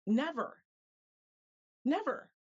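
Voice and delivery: defiant repeated never; emphatic never. Real, not acted. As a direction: slam the door on the idea. Emphatic answer, rising passion.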